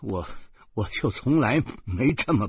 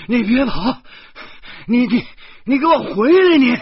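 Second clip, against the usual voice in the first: much higher and louder, flustered stammering shout; exasperated yelling; 10 dB louder than usual. Acted. Guardian chasing the runaway girl, furious yell. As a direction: yell after her, furious and out of breath. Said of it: "我就气急败坏的大声喊着".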